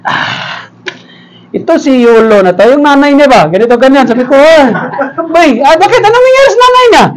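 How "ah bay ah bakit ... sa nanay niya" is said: high-pitched startled question; acted alarm. Pastor asking his wife about someone's mother, mock alarm. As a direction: climb into a gossipy mock shock. Retold shocked question in gossip.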